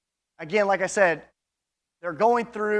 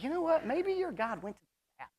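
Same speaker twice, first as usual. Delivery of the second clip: high, sly taunt at the rival prophets; mocking Elijah; 9 semitones higher, 8 dB quieter than usual. Elijah mocking the prophets of Baal: high, sly taunt. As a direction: tease them like a smug prophet on his altar. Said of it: "and he's like".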